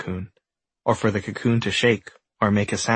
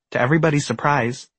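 ordinary delivery

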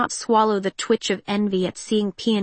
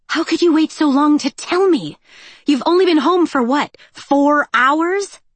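high, loud mock outrage; indignant outburst; 8 semitones higher, 6 dB louder than usual. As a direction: shriek in playful outrage. Peony slugs Cinder, indignant.

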